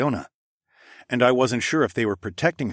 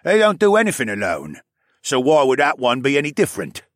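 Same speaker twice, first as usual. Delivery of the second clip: loud, higher-pitched arguing voice; insistent accuser; 5 semitones higher, 6 dB louder than usual. Acted. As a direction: argue the case to the queen. Narrator voices Nawel explaining.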